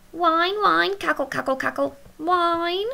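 high whining and cackling; whiny witches. A narrator's reading. Whiny witches taking stories for medicine, high cackling whine.

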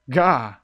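loud sudden gah of fright; real startled shout; startled yelp. Real, not acted. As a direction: yelp as something jumps out. Jump scare.

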